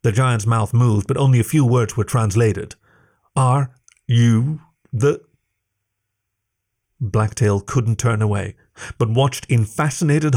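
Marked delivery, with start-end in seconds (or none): 3.3-5.2 slow, halting giant voice. A narrator's reading. Giant speaks through a faulty translator, slow and halting. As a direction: spell it out word by word, slowly.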